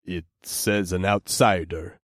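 sharp gruff orc retort. A narrator's reading. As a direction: shoot it back gruffly. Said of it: "the orc shot back".

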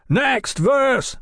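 high, crowing call; high-pitched rousing cry. A narrator's reading. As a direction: crow it out to the crew. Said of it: "Mallory crowed".

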